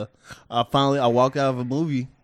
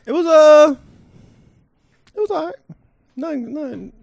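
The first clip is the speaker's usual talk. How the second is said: high voice; 11 dB louder than usual. Pretend. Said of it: "The people wanna hear from you".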